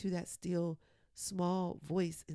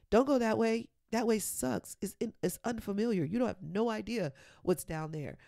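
loud, higher, insistent warning; loud external voice; 4 semitones higher, 7 dB louder than usual. Acted. External voice warning against the unfamiliar path, loudly. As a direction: play the alarmed inner critic at volume. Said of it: "that external voice got really loud".

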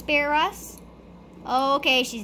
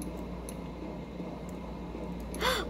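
sharp gasp; 8 semitones higher, 10 dB quieter than usual. Real, not acted. Sees the monster.

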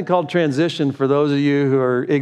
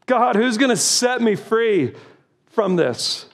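desperate plea; 9 semitones higher, slower than usual. Acted. Preacher cries out to God.

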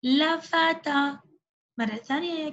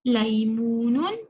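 slow modelled word; 3 semitones lower, 3 dB louder, slower than usual. Shown as a demonstration. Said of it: "إذن لام".

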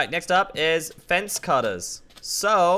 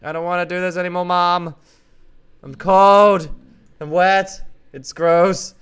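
louder whining complaint; loud whine; 3 semitones higher, 8 dB louder than usual. Real, not acted. Cold and wet in shower, loud whining.

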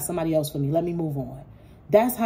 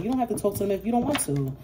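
ordinary talk, nothing marked

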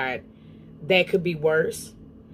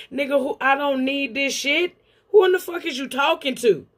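much higher, outraged pretend clapback at the husband; indignant comeback; 6 semitones higher than usual. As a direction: fire back high-pitched and offended, who do you think you're talking to. Talks back to the husband's words.

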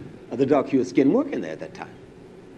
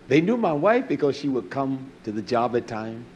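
ordinary talk, nothing marked

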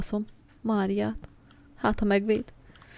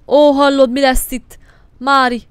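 panicked shout; 3 semitones higher, 13 dB louder than usual. Real, not acted. Panic as enemy comes.

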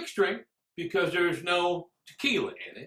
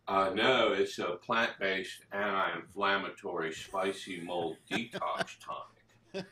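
low, deadpan cover story; deadpan excuse; 12 semitones lower, 5 dB quieter than usual. Acted. Drinker fobbing off a cop about his can, deadpan. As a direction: answer evenly in a low monotone, dragging out the last words. Acted answer to a cop's question.

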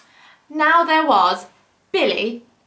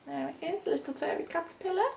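much softer pointing out; soft aside; 4 semitones higher, 13 dB quieter, much faster than usual. Real, not acted. Reader points out the caterpillar to the child, softly. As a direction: show the picture gently. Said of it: "pointing out picture".

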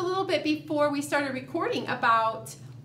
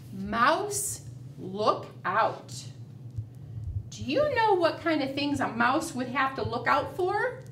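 ordinary talk

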